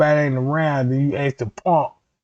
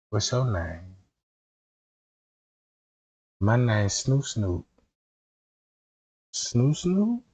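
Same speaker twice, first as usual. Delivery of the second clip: soft, low, slow seductive murmur; badly faked woman's voice, hushed and drawn out; fake female voice; 6 semitones lower, 6 dB quieter, slower than usual.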